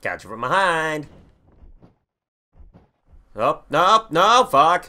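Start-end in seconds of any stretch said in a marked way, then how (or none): 3.3-4.9 frustrated yell. Real, not acted. Losing to game opponent.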